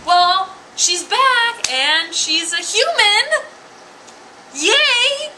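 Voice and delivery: high, loud little-boy impression building to a cheer; excited kid. Kid tells mom his goldfish is human, mock thrilled. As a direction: imitate a child bursting with news. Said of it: "he's like".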